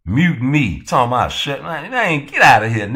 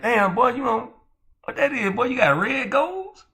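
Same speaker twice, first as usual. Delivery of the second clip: mock curious friend; 8 semitones higher than usual. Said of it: "imagined friend asking about teeth".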